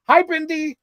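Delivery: chirpy greeting. Retold or imitated. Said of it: "And I was like".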